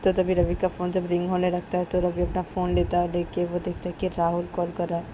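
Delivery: ordinary delivery, nothing marked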